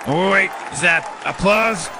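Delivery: normal talking voice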